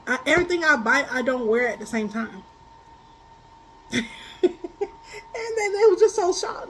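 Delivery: normal talking voice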